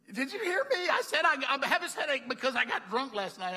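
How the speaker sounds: high, loud, insistent repeat; incredulous protest. Retold or imitated. Clerk to customer offering prayer despite the hangover, incredulous protest. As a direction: raise pitch and volume as if not heard.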